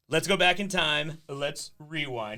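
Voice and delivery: slow, loud, dramatic build; dramatic announcer. Acted. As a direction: announce it like a movie trailer. Host launches the rewind segment.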